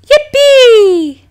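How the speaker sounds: high, loud gleeful squeal; child cheering over the photo album, high and loud; excited cheer. A narrator's reading. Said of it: "Bella exclaimed".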